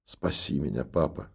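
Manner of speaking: very soft, low, faint plea; weak whisper. A narrator's reading. Sick daughter faintly whispers to her father, weak. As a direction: barely breathe the words to him. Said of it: "слабо улыбнулась и прошептала".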